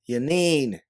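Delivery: high, loud call falling at the end; yelling a name. A narrator's reading. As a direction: yell to get someone's attention. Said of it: "you yell as you refocus back on her".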